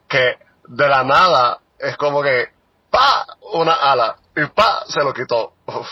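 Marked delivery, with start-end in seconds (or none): 2.9-3.2 high, punched burst of "pa"; sudden "pa" effect. Retold or imitated. Mimics a sudden reveal. 4.4-4.8 high, punched "pa"; sudden "pa" effect. Retold or imitated. Mimics a sudden reveal.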